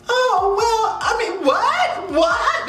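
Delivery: high, nervous laughing stammer; nervous laughter. Retold or imitated. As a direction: fake innocence through anxious giggles. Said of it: "This nervous ass fucking laughter".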